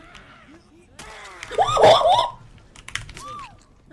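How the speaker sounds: loud, high burst of laughter; shrieking laugh. Real, not acted. Streamer caught off guard by a jump scare, shrieking laughter. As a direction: let out a shrill shriek of laughter. Bursts out after being startled.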